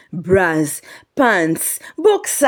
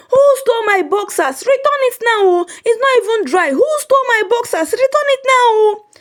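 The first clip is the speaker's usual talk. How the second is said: frantic wailing. Acted. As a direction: cry out like a robbed man. Ikechi demands boxers back.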